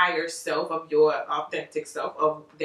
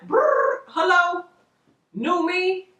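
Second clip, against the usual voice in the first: excited new me; 11 semitones higher, 5 dB louder, much slower than usual. Acted. Playing the new star self.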